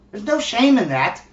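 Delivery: normal talking voice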